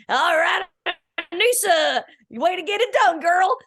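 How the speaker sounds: imitating a Southern accent. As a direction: do a hammed-up Southern cheer for Anissa. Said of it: "he put on a Southern accent".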